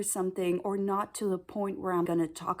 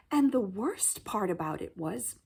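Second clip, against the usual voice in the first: usual speaking voice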